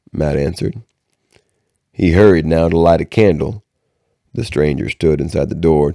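ordinary delivery

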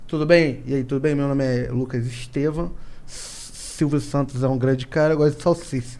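soft lisping voice, s turned to sh; mock lisp. Shown as a demonstration. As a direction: lisp every s like sh. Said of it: "se eu tento fazer língua presa, tirar a língua sibilante". (fala com língua presa, s chiado)

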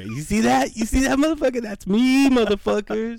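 high-pitched, excited bragging; crowing boast. Acted. Guy bragging that it's him on screen, crowing boast.

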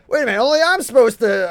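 high, loud, fast whiny protest; mock indignant rich guy. Hedge funds whining they lost control, mock outrage. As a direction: squeal it like a spoiled banker.

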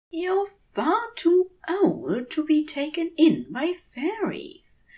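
a gentle, mock-stern telling-off to himself; a hint of a fussy gentleman chiding himself; Aziraphale scolding himself. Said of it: "he tells himself".

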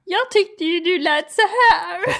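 high, slow, whiny caricature of a friend's voice; Chris's voice. Retold or imitated. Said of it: "första gången jag fick höra Chris röst".

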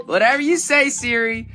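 normal talking voice

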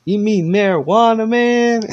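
high, loud, drawn-out mock question; stoned wise guy. Dilly leaning out to correct the stranger, high loud mock question. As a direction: act the stoner correcting him. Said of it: "He says".

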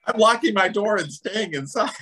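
high laughing voice. Real, not acted. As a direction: say it through laughter.

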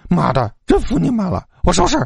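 high, exasperated giving-in; through gritted teeth. A narrator's reading. Roommate forced to clean up, grudgingly angry. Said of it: "老七咬着牙说道".

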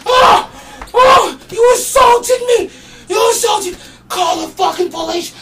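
very high, screaming imitation; hysterical victim. Punched bum crying assault, hysterical mock scream. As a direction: wail in hammed-up outrage, loud and shrieking. Punched man's outcry, acted.

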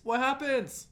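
high, breathless "What happens?" like a gossiping fan; hammed-up eager gasp of a question; mock excited. Co-host begging to hear the plot, high and eager.

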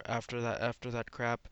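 ordinary delivery

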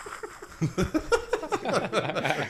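high, helpless belly laugh; big laugh. Real, not acted. Cracking up at the bad accent clip, big laugh.

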